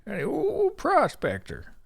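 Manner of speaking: lightly voiced old-timer; old prospector. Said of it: "I brought on the old Prospector".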